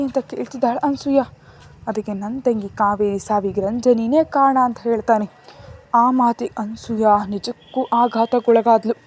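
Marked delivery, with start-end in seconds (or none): none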